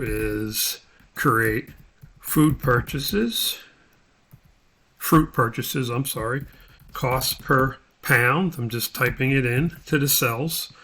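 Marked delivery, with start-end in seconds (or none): none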